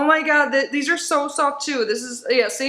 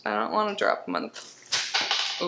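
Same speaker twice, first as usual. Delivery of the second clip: breathy whisper, barely voiced; whispered under the breath; whispered worry; 5 semitones lower, 7 dB quieter than usual. Real, not acted. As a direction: murmur it to yourself, careful not to drop them. Carefully handling small items.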